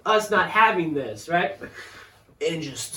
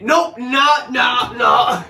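loud, high string of no's; alarmed refusal; 6 semitones higher, 7 dB louder, much slower than usual. Retold or imitated. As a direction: retreat in mock panic, nearly shouting. Retold instant regret, fleeing customer.

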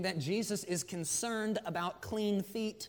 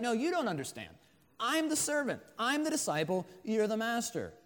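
higher, insistent protest; 6 semitones higher than usual. Acted. Disciple contradicts his master, protesting. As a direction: argue back in short, high-pitched bursts. Peter argues back at Jesus, retold.

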